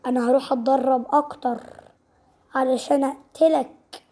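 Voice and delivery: usual speaking voice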